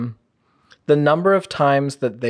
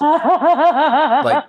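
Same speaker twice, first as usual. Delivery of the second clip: laughing; 13 semitones higher, 4 dB louder than usual. Real, not acted. Laugh mid sentence.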